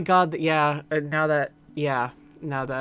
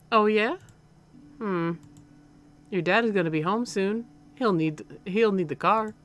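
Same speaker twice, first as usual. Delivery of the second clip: higher-pitched Southern mother; lightly Southern, a mom talking to her son; slightly southern accent; 5 semitones higher, 3 dB louder than usual. Acted. Mom telling her son about the car, slightly southern accent. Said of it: "like, a slightly southern accent".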